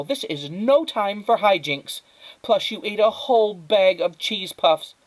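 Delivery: high, loud lecturing tone; stern scolding. Acted. Mother scolding Flora over the typewriter, stern. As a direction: scold her firmly.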